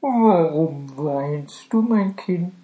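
slow, gentle comfort; tender cow. Acted. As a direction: soothe the crying child. Cow comforts crying girl.

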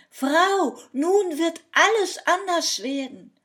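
loud, high-pitched triumphant call; calling from afar. A narrator's reading. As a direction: call out to your wife from a distance. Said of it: "da rief er schon von weitem". (von weitem laut gerufen)

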